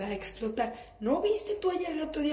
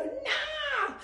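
put-on kid's whine; 13 semitones higher than usual. Kid refusing at the pool.

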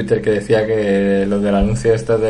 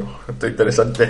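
a hint of a pretentious reader, softer; mock pretentious reader; 5 dB quieter than usual. Friend mocks posers reading on the street, a hint of a pretentious reader. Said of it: "me pongo ahí".

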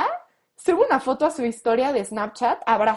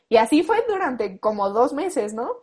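ordinary talk, nothing marked

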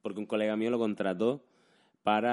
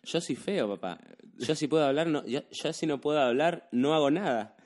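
put-on Argentine accent; 5 semitones higher than usual. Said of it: "conocí a un argentino y me dijo".

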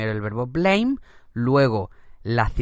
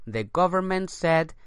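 slow model sentence; 3 semitones higher, much slower than usual. Shown as a demonstration. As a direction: read it slowly for learners. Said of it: "teacher models example".

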